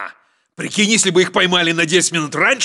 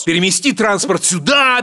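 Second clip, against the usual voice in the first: ordinary talk, nothing marked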